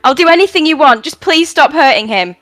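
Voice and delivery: pleading in desperation; desperate plea. Acted. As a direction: beg him to take her instead. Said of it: "she turns to Zyra".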